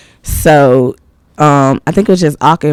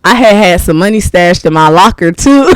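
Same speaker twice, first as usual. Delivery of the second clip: normal talking voice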